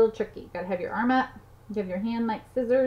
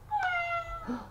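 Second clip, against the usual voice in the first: gasp; 6 dB quieter than usual. Pretend. Surprised gasp at toy reveal.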